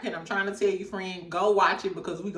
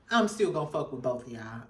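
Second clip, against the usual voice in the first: smooth suave player; 9 semitones lower, 6 dB quieter than usual. Retold or imitated. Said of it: "he said it in such a smooth, suave way".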